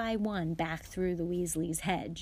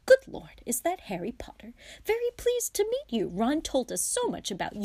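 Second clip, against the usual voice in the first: high, loud, quick exclamation; startled father gushing a welcome; startled delight; 8 semitones higher, 5 dB louder than usual. A narrator's reading. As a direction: burst out in surprise, then greet excitedly. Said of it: "and jumped".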